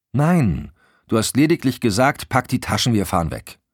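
high, loud opening no, indignant; annoyed objection. A narrator's reading. Wife contradicting her husband in the car, annoyed. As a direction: cut him off, exasperated.

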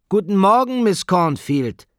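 very high, loud schoolroom singsong; resounding class greeting. A narrator's reading. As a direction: chant it back like a class of pupils, drawing out the name. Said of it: "schallte es zurück".

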